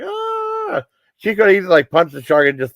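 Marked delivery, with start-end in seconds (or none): 0.0-0.8 a sudden high, thin mock shriek; mock scream. Woman retold frozen before the shark, mock high shriek. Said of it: "She's like".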